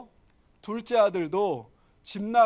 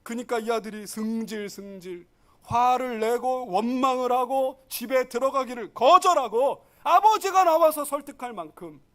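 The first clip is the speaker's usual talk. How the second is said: ordinary delivery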